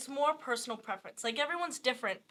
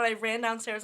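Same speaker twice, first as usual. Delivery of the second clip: ordinary talk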